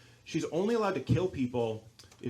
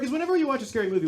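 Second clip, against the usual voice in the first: ordinary talk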